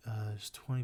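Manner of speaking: ordinary delivery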